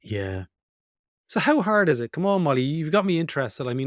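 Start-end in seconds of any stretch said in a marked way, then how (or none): 1.3-3.7 higher, louder, keen push; eager prompting. Real, not acted. Host urging Molly to tell more, excited and louder. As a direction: egg her on with real interest.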